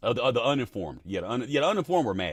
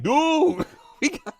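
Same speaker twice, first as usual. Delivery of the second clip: mock amped-up, higher voice with a laugh; 10 semitones higher than usual. Streamer mocking himself over taxes, higher amped-up voice. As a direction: play your fired-up older self, half laughing. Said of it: "so amped up".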